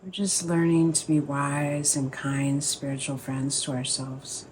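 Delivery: calm, unhurried meditation guide, pausing between phrases; guided meditation. Acted. Teacher easing the group into a sit, guided meditation voice.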